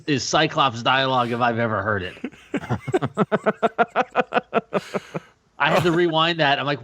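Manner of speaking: ordinary talk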